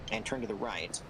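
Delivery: normal talking voice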